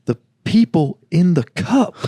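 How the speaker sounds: imitating the sibling's sleep-talk, slow and sure; slow dreamy insistence. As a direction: say it like it's obvious, still dreaming. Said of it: "You go".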